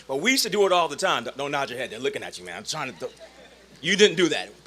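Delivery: normal talking voice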